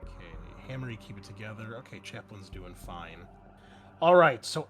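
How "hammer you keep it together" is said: normal talking voice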